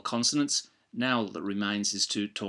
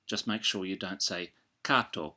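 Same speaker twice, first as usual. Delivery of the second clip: ordinary talk, nothing marked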